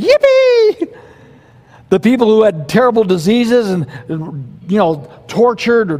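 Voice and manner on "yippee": high, loud squeal of glee; joyful yippee. Pretend. Pastor voicing the saved in heaven, gleeful high squeal. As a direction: squeal it like a delighted child. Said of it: "They're just gonna be saying".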